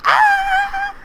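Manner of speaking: high shrieking squeal of joy; excited scream. Real, not acted. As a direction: shriek with delight. Ship finally appears.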